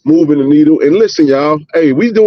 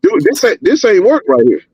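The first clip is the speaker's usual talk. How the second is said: excited; 10 semitones higher than usual. Real, not acted. Excited insistence on a point.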